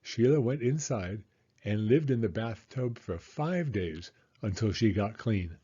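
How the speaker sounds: usual speaking voice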